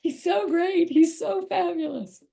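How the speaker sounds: high, loud, voice on the edge of tears; overwhelmed with love for her son, welling up; getting emotional. Real, not acted. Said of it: "I'm getting too emotional now".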